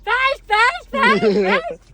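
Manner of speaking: high, loud imitation of nagging yells; mock shouting. Wife shows how husband shouts at her, high mock yelling. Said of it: "असं ओरडतो का रे हर्षद?".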